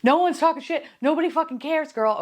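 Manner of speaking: put-on high, emphatic girl-talk; exasperated reassurance. [name] telling Lindsay nobody cares, high and exasperated. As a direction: brush off her paranoia.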